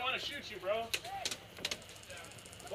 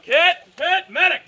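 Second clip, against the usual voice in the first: shouting; 5 semitones higher, 20 dB louder than usual. Real, not acted. Shot, calling for medic.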